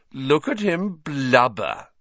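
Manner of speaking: jeer, dragging the words out; mocking jeer. A narrator's reading. Malfoy jeering at weeping Hagrid, mocking.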